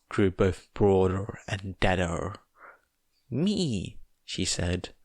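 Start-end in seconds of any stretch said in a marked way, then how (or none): 3.3-4.0 put-on higher small child voice; lightly voiced little girl; child's answer; little girl Eri. A narrator's reading.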